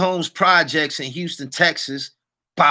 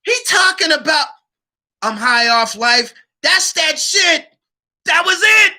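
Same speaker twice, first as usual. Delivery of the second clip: higher, louder, near shouting; hyped; 9 semitones higher, 5 dB louder than usual. Real, not acted. Quoting [name] to viewers, hyped.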